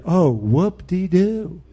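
slow, unimpressed sarcasm; dismissive, drawn-out mockery; sarcastic. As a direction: drag it out with mock celebration. Sarcastic reply to half-hearted converts.

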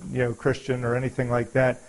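ordinary talk, nothing marked

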